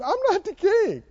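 high blubbering mock whine; hammed-up crybaby protest; mock crying. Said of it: "started crying and said".

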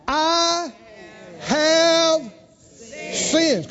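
high, drawn-out I have sinned; drawn-out confession. Shown as a demonstration. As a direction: stretch have like a broken confession. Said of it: "Come on, somebody try it out".

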